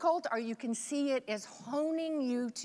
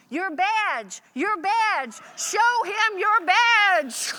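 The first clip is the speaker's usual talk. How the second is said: acted full-voice yell, pitched way up; 9 semitones higher, 10 dB louder, much slower than usual. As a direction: imitate a father's big shout, high and loud. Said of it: "my father yells out".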